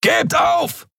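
high, barked order to surrender; shouted demand. A narrator's reading. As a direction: yell at them to give up. Thug shouting at cornered crew.

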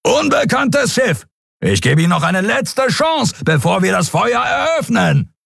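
very high-pitched, commanding shout; shouted ultimatum. A narrator's reading. Captain warns the fleeing ship before firing, shouted ultimatum. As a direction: hail the ship and threaten it at full cry. Pursuing captain threatens to open fire.

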